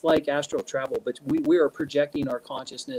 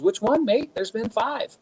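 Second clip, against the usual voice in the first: high, playful imitation Kiwi; New Zealand accent; 7 semitones higher than usual. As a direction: mimic a New Zealander's offhand reply. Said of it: "you're from New Zealand".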